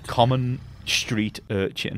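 slow, plummy upper-class English; posh English accent. Pretend. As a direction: put on a very proper English voice. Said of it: "I'm going to speak in the most correct and proper English way".

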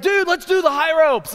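hyped-up dude voice; eager friends. Retold or imitated. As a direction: pitch it like an eager kid, loud and up. Eager friends pitching high ropes.

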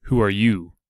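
same question, harder and colder; a hooded archer demanding a name, harder tone. A narrator's reading. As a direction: press him with a harder edge. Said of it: "then continued in a harder tone".

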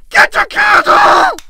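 high, loud squeal of urgency; panicked shriek. Real, not acted. Player desperate for a candle, panicked shriek.

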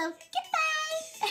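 high, slow drawn-out cartoon goodbye; Lily voice. Kid trying Lily's goodbye, high slow toon voice. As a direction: stretch the goodbye like sweet little Lily. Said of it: "I sound like Lily".

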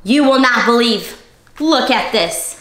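ordinary delivery, nothing marked

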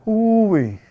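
high, loud falling "ooh-wee"; mock venting wail. Pastor voicing hidden strain under the surface, mock venting wail. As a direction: let out a high hammed-up wail. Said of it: "But underneath the surface, you're like".

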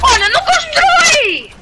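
shout. Real, not acted. Alarmed call in game.

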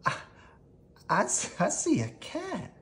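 scared stammer. Acted. Frightened mouse stammering.

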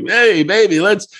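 hammed-up ladies' man; pick-up guy. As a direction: imitate a pushy flirt hitting on someone. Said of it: "I'm not the guy who's like".

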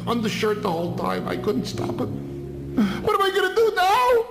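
a father wailing for his lost son; frantic father. Acted. Father searching for his son, panicky high wail.

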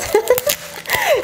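short high-pitched giggle; a quick embarrassed laugh. Real, not acted. Stumbling over her words, she lets out a high giggle.

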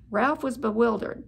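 ordinary delivery, nothing marked

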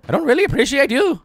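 high, loud squeaky put-on complaint; mock indignation. Streamer repeating the complaint, high mock indignation. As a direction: push it up high, mock offended.

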